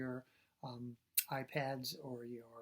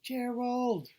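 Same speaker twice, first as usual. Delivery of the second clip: loud high call again; loud call for help; 9 semitones higher, 7 dB louder than usual. Acted. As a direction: call his name, pleading. Piggy calling again.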